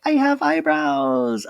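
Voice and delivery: put-on young daughter showing off her makeup; cute teen voice. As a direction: say it like a proud little girl.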